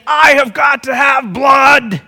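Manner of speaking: slow, high, thundering rage; raging shout. Acted. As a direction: roar it slowly like a wrathful God. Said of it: "he would rage throughout the universe saying".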